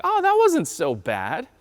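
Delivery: high, drawn-out child impression; relieved little boy. Preacher mimics his son after the tooth, cheerful relief. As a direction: imitate a kid suddenly fine after tears. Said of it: "And he smiled".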